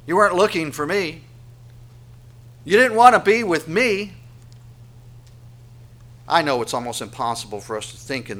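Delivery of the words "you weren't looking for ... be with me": higher, louder, aggrieved plea; raised-pitch, pleading reproach; hurt accusation. Acted. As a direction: press each accusation as a wounded rebuke. God's plaintive accusation, voiced by preacher.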